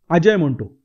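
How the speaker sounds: usual speaking voice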